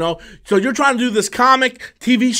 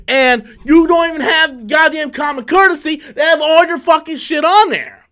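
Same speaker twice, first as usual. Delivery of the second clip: angry rant; 6 semitones higher than usual. Real, not acted. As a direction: yell at the streaming service in real frustration. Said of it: "I don't know why I'm so pissed off".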